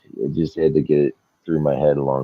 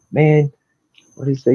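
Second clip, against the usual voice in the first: mock whiny complaint; 12 semitones higher, 4 dB louder than usual. Driver mocked, complaining.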